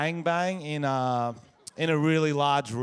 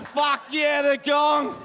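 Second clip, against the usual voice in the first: big delighted yell at the crowd, much higher and louder; excited shout; 10 semitones higher, 4 dB louder, much slower than usual. Real, not acted. Comic yells to a fellow Wollongong local in excitement. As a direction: roar it like you found a hometown mate.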